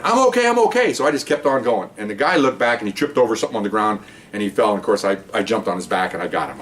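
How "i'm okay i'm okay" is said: high-pitched imitation of partner yelling; mock yell, pitched way up, holding steady; retold yell. Hurt partner insisting as officer reaches her, retold yell. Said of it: "she's yelling".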